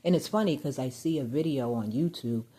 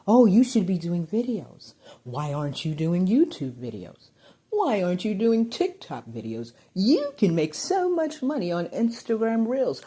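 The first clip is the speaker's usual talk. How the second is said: lightly voiced salesy nagging, a bit louder and higher; hint of a pushy online promoter; mock pushy influencer voice; 5 semitones higher, 6 dB louder than usual. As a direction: parrot the YouTubers pestering her. Mimicking YouTubers urging her on.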